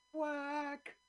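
very high drawn-out duck quack; seductress duck call. Pretend. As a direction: coo a high sultry quack. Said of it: "something that sounds like a seductress duck".

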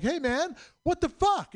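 mad guy confronting a line-cutter; retold mad stranger. Angry man cut in line at Safeway, retold indignation. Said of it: "he got really mad".

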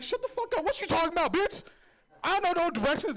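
very high, loud hammed-up tough talk; mock-intimidating, over the top; tough guy fantasy. What he should have said.

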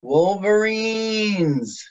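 slow, high, drawn-out call; overacted death scene. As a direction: moan the name like a dying hero.

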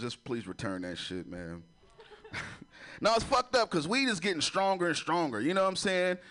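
ordinary talk

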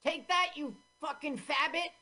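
high-pitched combat taunt; mock action hero. Voicing a shooter in the shootout, high mock taunt. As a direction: throw it out as a high cartoonish battle cry. Voices character in film shootout.

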